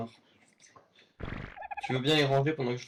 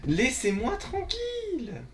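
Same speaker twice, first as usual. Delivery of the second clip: high, slow drawn-out wail; panicked wail; 12 semitones higher, much slower than usual. Real, not acted. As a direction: plead to be left alone. Chased by piranha.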